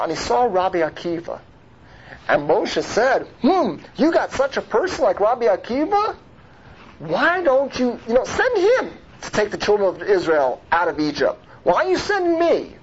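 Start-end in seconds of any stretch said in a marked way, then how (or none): 3.4-12.8 high-pitched, questioning protest as Moses; acted plea of someone challenging an order. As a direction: push back in rising, incredulous questions.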